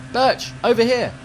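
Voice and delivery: high call across a field. Acted. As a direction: call for him from far away.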